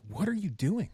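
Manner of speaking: high, puzzled voice for the staff; mock confused nurses. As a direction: play the baffled coworkers. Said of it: "They're like".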